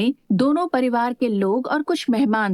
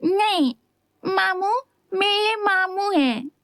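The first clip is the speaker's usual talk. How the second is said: put-on much higher, slow sulky boy voice; pouting child; 9 semitones higher, slower than usual. A narrator's reading. Little boy sulking at his mother over his uncle, pouty. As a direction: pout it like a small boy. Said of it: "मुंह फुला लिया और कहा".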